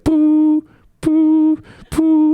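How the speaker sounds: loud, high flat beeps imitating a dropped call; phone cutting out. Grandmother's call from South Africa cutting out, loud high beeps. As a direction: beep like a phone line going dead. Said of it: "the South African phone signal cut out".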